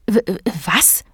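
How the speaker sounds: stuttered, halting first sound; startled stammer. A narrator's reading. Startled Emma answers the policeman, stammering. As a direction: stammer in fright before getting the word out. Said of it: "stotterte Emma erschrocken".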